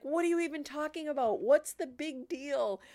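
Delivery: high-pitched exasperated protest; pissed-off husband. Retold or imitated. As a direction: mimic a baffled, annoyed husband arguing back. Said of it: "my husband was pissed".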